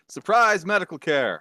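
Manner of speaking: grand announcement. Acted. Sneaks up with surprise healing.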